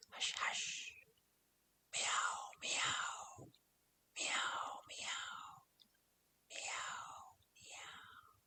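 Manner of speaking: whispered meows fading away; whispered hush and meows. Acted. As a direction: breathe the meows out without voice, dying off. Kittens hush for the rat.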